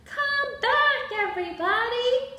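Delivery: high, acted cross call. A narrator's reading. Abigail calling her runaway friends back, acted cross. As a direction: call them back in storybook annoyance. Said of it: "said Abigail crossly".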